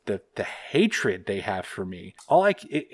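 ordinary delivery

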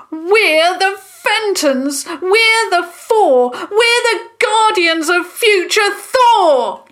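high, loud, slowed war cry; building, defiant yell; rallying shout. A narrator's reading. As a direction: proclaim it at the top of your voice. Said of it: "Tom shouted".

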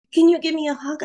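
put-on high, breathy child gasp then a small plea; a scared kid reaching for comfort; frightened little boy. Five-year-old son gasping and asking his mom for a hug.

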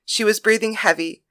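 ordinary delivery

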